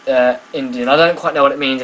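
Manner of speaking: ordinary talk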